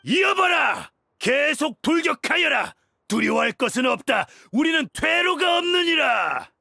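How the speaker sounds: high, shouted rallying orders; commander's battle cry. Acted. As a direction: drive the troops on, stretching the last words. Commander urges troops onward. (돌격을 독려하는 우렁찬 호령)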